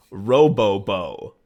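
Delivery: drawn-out, punched catchphrase; savored catchphrase. Retold or imitated. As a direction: relish each syllable, loud and slow. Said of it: "they could say over and over".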